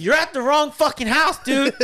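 high, loud retold yell; exasperated shout. Friend to drunk at wrong house, exasperated shout. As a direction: holler it at a drunk friend, acted out.

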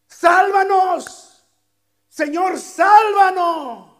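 loud, high, slow cry for salvation; drawn-out imploring shout; desperate plea. Acted. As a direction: beg the Lord at full voice. Preacher cries out the plea.

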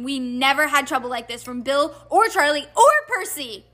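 high, ranting scolding in bursts; raging mother. A narrator's reading. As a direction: let a worried mother's rage break over them. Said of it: "her rage broke over them".

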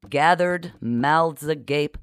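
ordinary delivery, nothing marked

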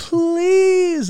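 high, pleading desperate voice. Retold or imitated. Man begging at the bathroom door, desperate high plea. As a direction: beg to be let in, voice straining high.

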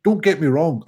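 usual speaking voice

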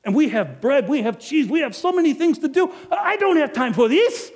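high, loud and harried; a busy innkeeper complaining to the audience, high and loud; overwhelmed rant. Acted. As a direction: list the chores like it's all too much.

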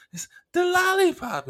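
high, loud squeal; giddy, very high revelation; helpless laughing, reenacted. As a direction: squeal it insistently in mock hysterics. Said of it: "I was laughing really bad".

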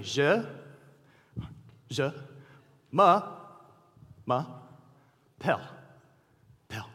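"Je, ma, pelle" split into single syllables with pauses; teacher drilling French sounds. Acted. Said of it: "Repeat after me".

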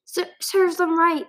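high, loud, slow bitter call; called out bitterly. A narrator's reading. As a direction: spit it out with spite. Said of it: "she called".

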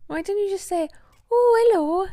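high, singsong South Wales accent; Welsh accent. Shown as a demonstration. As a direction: talk like a Welsh uncle, lilting high. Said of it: "I'm just doing a Welsh accent".

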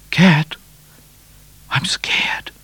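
high call sinking into a whisper; scared whisper. A narrator's reading. Midnight to Cat in the night, scared whisper. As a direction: call out, then whisper in fear. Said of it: "whispered Midnight".

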